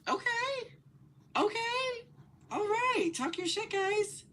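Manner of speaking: high, loud, excited hype; hyped cheer. Real, not acted. Reactor cheering the podcast hosts on, high and loud.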